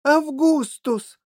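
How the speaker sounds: high, loud, drawn-out plea; imploring. A narrator's reading. Factory owner begging the boy, imploring. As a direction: stretch the name into a desperate appeal. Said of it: "с мольбой в голосе".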